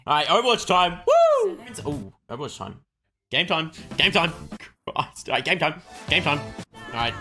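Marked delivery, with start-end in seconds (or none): 1.1-1.6 loud, high "woo"; high cheering whoop; excited whoop. Real, not acted. Cheering for Overwatch time.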